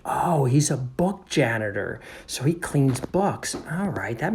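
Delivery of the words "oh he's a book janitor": ordinary delivery, nothing marked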